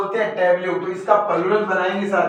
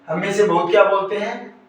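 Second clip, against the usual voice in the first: ordinary talk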